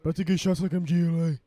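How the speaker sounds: loud rapper impression. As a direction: rap it out loud like a parody drill rapper. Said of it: "'cause I'm [name]".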